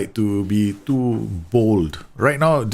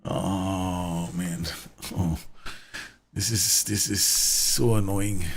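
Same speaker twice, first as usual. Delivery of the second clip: dejected groan; 5 semitones lower, 7 dB quieter, much slower than usual. Real, not acted. Penalty conceded, frustrated.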